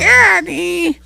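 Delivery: put-on high loud boy whine; a child wailing for dad; whining kid.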